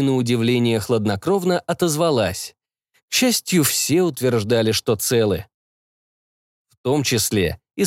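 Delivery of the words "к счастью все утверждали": ordinary delivery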